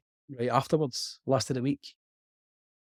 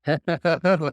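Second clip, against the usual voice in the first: burst of laughter; 5 semitones higher, 7 dB louder than usual. Real, not acted. Delighted by collab idea.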